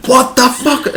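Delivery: high mock panic; panicked what the fuck. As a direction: yelp it out high and startled. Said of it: "It's like".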